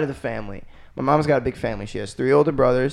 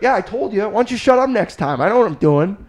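higher mock smug told-you-so; smug dad; 8 semitones higher, 4 dB louder than usual. Dad gloating to son about the restaurant, mock smug. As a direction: gloat like a know-it-all dad. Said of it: "He's like".

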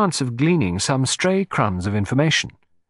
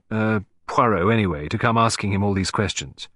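ordinary delivery, nothing marked

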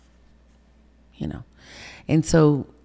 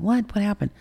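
high, startled questions; retold surprise; 8 semitones higher than usual. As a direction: imitate your own surprised questioning, pitched up. Retells her alarmed questioning.

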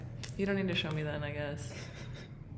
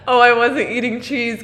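can barely get the words out laughing; laughing retold denial. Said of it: "I dead ass said".

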